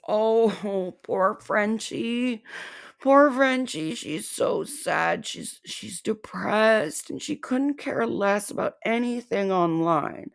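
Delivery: mock pity. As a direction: coo over her in fake sympathy. Mocking pity for target.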